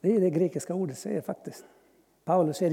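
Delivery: ordinary talk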